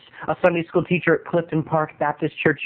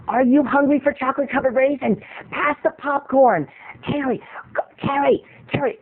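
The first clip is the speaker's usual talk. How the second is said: higher, insistent mom voice; 5 semitones higher than usual. Retold or imitated. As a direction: nag like a mother in the dark theater. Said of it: "my mom goes".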